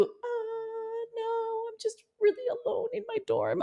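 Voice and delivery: high, soft mopey voice; mock pitiful caller. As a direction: whimper it lonely and small. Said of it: "I can imagine the call".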